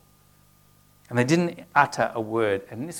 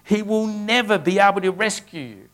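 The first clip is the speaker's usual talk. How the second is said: higher and louder, a bold commander mocking the city; scripture read as an enemy's loud, bold taunt; 6 semitones higher, 4 dB louder than usual. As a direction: call it up to the wall with swagger. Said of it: "louder and with more boldness".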